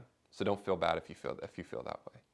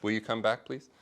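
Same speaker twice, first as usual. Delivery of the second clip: ordinary talk, nothing marked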